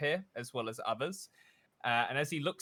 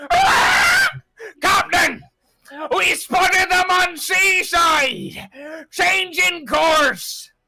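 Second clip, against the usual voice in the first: much higher and louder, slow cry; lookout creature shout; 14 dB louder, much slower than usual. Acted. Creature lookout shouting the enemy's position to the captain. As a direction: call the sighting across the deck.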